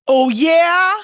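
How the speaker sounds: loud, high hag taunt; hag's retort. Acted. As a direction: throw it back as a challenging hag. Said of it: "and she goes".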